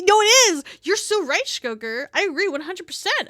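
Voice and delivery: loud, high outburst; emphatic agreement. Real, not acted. Streamer agreeing with a chatter about Frostpunk, excited outburst. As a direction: exclaim it with eager conviction.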